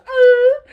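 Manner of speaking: high, loud, tearful aww; heart melting. Retold or imitated. A dad voices his melting heart at his son's hug. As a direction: let the heart melt out loud. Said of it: "my heart goes".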